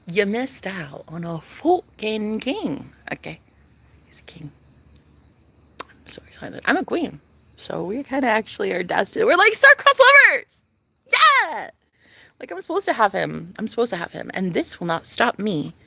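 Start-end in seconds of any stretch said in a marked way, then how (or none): 4.1-6.6 very soft giddy whisper; whispered giddy aside to the camera. Real, not acted. 9.3-11.7 much higher, louder gushing; squealing about her destined love; excited squeal. Real, not acted.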